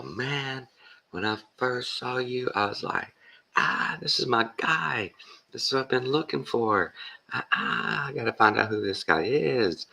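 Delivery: high-pitched gushing fanboy impression; imitated awestruck comedian, way up in pitch; starstruck excitement. Retells comedian thrilled at first sight.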